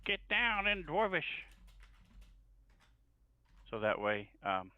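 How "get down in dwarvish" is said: high, drawn-out warning call, little added volume; acted yell. Halfling yells toward the noise to warn Mog, calling out. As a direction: stretch it out like a called warning. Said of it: "He's going to yell".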